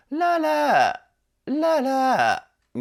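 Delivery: ララー sung high and slow, ending in a creaky fry; vocal fry. Shown as a demonstration. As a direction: sing ララー long and high, fry at the tail. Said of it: "今僕はあんまりちょっと上手くなくて". (エッジボイスで歌うララー)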